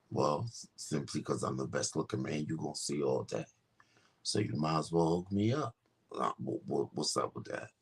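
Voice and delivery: very low, quiet smooth-talker; deep flirting voice. Acted. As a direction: woo them from the bottom of the range. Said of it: "I said".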